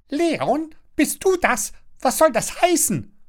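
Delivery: very high, prattling neighbour; high-pitched busybody voice; Frau Schnattermann chattering. A narrator's reading. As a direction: squawk questions in bewilderment. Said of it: "schnattert Frau Schnattermann".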